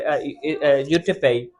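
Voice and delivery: ordinary talk, nothing marked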